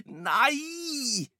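high, drawn-out wail of protest; dismayed cry. Acted. Albert sees the cookies dropped in a puddle, crying out. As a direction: stretch the word in high-pitched dismay.

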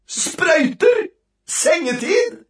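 very high cries of dread; horrified. A narrator's reading. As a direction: cry each out in mounting horror. List of boy's most dreaded things.

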